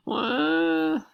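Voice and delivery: high wailing cry; child bursting into tears at her desk; mock wail. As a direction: wail like a baby. Mock crying.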